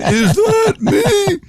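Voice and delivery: high, slow, stunned squeak; disbelieving high-pitched wonder; amazed at own voice. Retold or imitated. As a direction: hear your recorded voice and gasp it out in disbelief. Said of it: "And I went".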